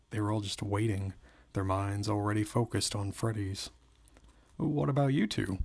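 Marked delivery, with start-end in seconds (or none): 4.6-5.6 higher, curious question. A narrator's reading. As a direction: ask it lightly with pitch lifted. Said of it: "Jessica asked".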